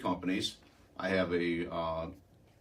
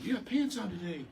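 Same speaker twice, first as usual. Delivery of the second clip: much higher-pitched imitated yell; retold yell; 12 semitones higher than usual. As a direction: mimic a woman calling across a counter. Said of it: "a lady yells across the counter".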